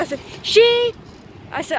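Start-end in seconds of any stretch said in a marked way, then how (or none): 0.4-0.9 sudden high, loud cry; angry outburst. Retold or imitated. Student cutting in angrily to the driver. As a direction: burst out in anger.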